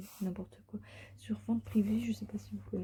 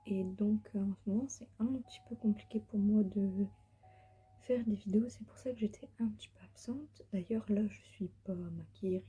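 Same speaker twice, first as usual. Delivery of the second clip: ordinary delivery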